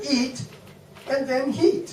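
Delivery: imitating how the French misplace H, mock accent. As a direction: drop the H from heat and add it to eat. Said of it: "So the French would say".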